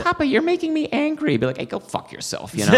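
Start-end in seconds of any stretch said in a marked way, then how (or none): 0.0-1.3 high, fast mock child voice; lightly hammed-up childish whine; mock daughter quote. Mock German father quote. 1.6-2.5 whispered, laughing comeback; hushed joking brush-off; mock retort. Imagined retort.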